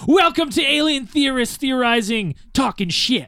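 high, announcer-style welcome; mock show intro. Opening the podcast, mock announcer voice. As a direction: hype it like a show host.

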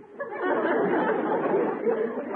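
very high, unvoiced nervous laugh; flustered chuckle. Customer flustered by the druggist's literal answers, high nervous laugh. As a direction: laugh to cover embarrassment.

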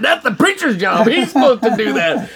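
high, indignant backtalk; comic protest. Retold or imitated. Man argues with God about whose job it is, comic protest. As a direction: protest high-pitched, on the edge of laughing.

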